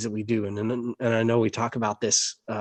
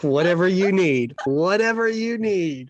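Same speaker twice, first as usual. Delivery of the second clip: smitten gushing; 6 semitones higher, 4 dB louder than usual. Acted. Man won over by a British accent, mock-smitten gushing. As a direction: gush as if helplessly charmed, hammed-up.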